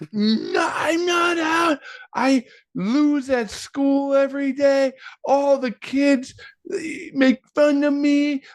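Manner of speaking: very high, drawn-out mock wail; hammed-up tearful child whine; imitated child meltdown. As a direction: wail like an overtired kid losing a game. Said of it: "just sent him over the edge".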